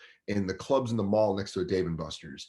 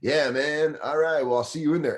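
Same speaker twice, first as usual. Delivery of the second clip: enthusiastic fan; 6 semitones higher, 5 dB louder than usual. Retold or imitated. Voicing the enthusiastic fan's reply.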